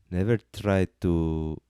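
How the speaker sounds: slow attempt at a Scottish accent; drawn-out try at a Scottish accent. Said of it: "I try to do a Scottish accent".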